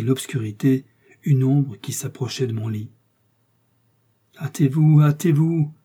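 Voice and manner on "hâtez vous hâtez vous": pressing plea to hurry; urgent interruption. A narrator's reading. Felton interrupts Milady's account, urgent and impatient. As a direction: cut in urgently, higher and louder. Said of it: "interrompit Felton".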